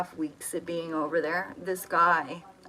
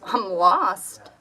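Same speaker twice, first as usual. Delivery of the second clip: distressed cry; 9 dB louder than usual. Retold or imitated. Telling stranger she is lost.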